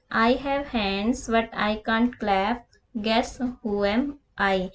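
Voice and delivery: very slow, each word spaced out for the class; unhurried classroom read-aloud; slow riddle reading. Shown as a demonstration. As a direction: read it like dictation to children. Teacher reads riddle aloud to class.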